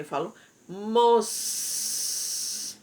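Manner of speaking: "mos" drawn out very slowly and held; slow syllable. Shown as a demonstration. Teacher showing pupils the first syllable, very slowly. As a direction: stretch "mos" long and slow. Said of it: "quando eu falo essa boquinha".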